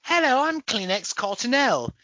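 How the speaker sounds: put-on British advert accent. Clean-product spokesperson greeting viewers, mock British accent. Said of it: "anything that's clean or fresh is British".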